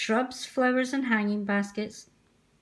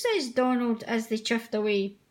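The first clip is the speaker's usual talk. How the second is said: ordinary delivery, nothing marked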